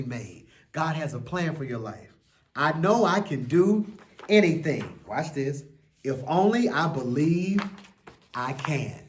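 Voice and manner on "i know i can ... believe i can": put-on an expressive children's book reading; story reading. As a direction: read it like a bedtime picture book. Reads picture book aloud.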